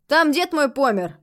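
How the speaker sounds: high, loud, ready account; eager explanation. A narrator's reading. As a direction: offer it up keenly. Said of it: "с готовностью объяснил мужчина".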